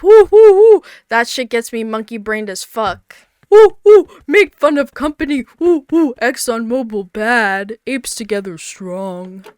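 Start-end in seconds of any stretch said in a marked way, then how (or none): 0.0-0.8 loud high monkey hoots; ape hoots. Pretend. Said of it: "beating my chest like an ape". 3.5-9.4 caveman ape voice. Pretend. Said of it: "beating my chest like an ape".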